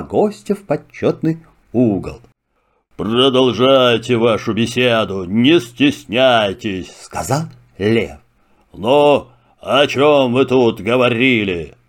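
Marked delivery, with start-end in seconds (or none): 3.0-6.9 low, measured royal lion. Acted. Lion speaks. 8.7-11.7 lion voice. Acted. Lion speaks.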